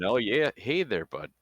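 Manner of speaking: put-on loud, slow, hammy Canadian accent. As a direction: drawl it like a friendly Canadian. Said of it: "your Canadian accent kicked in".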